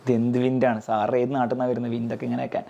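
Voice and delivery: low-pitched mock student grumble; complaining student. Students questioning sir's wind noise, low mock complaint. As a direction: play a sceptical pupil, pitched well down.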